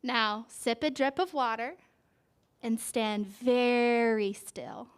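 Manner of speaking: slow, gentle picture-book read; reading to a child. A narrator's reading. Parent reading a flower book to a child, gentle storytelling. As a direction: draw the child into the page.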